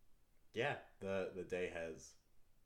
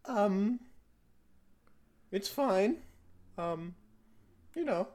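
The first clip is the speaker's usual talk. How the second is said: put-on higher, louder evasive child; hesitant kid voice; 11 semitones higher, 6 dB louder, slower than usual. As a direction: daughter avoiding her parent's question. Daughter answers about enchantment.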